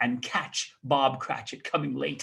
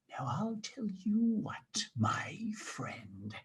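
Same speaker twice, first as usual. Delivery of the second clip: soft, breathy mock-threatening whisper; menacing whisper; 3 semitones higher, 10 dB quieter, much slower than usual. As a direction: lean in and pretend to threaten. Scrooge feigns menace.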